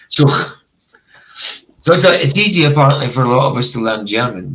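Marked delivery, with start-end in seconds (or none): 0.1-0.6 "Chuch" with a scraped final "kh"; Scottish throaty ch. Shown as a demonstration. Repeating their own way, throaty ch ending. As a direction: let the end of "Chuch" rasp as in loch. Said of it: "I say chuch".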